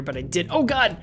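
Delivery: ordinary talk, nothing marked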